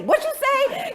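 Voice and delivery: very high squeak of confusion; mock baffled parent; confused. Parent on the phone who can't hear, baffled.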